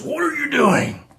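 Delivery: high, drawn-out creature demand; beholder's voice. Acted. Beholder addressing the intruders, high drawn-out creature voice. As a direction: raise it loud and slow, like an affronted monster. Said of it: "And it goes".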